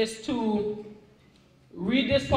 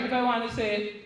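usual speaking voice

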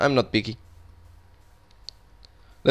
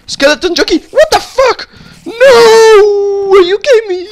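very high, loud disbelieving scream; real furious disbelief; outraged shriek; 10 dB louder, slower than usual. Real, not acted. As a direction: shriek in outrage at the skeleton jockey. Rare mob attacks him.

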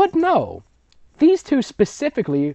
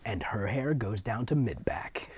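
whispered aside; 2 semitones lower, 8 dB quieter than usual. Real, not acted. Whispering the repeated line.

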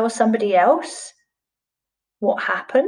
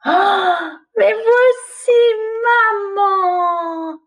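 high, louder cry of joy; delighted cry; 11 semitones higher, 4 dB louder, much slower than usual. Acted. Little fish cries out with joy on finding his mother. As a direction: burst out happy at finding mum. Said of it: "dit Petit Poisson Blanc en souriant".

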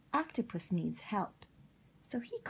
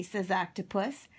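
usual speaking voice

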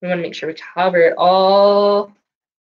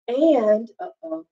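usual speaking voice

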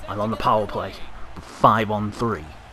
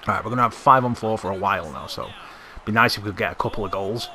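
ordinary talk, nothing marked

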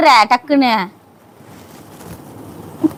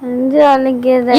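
weak drawn-out moan; slower than usual. Acted. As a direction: moan it weakly, as if barely able to speak. Wife fakes chest pain. (மெதுவாக முனகும் வலிக்குரல்)

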